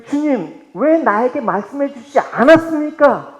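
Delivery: high, loud anguished plea to the Lord; frustrated Judas lament. Acted. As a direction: cry out like a betrayed man asking why. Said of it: "좌절하면서 그렇게 읊조리는".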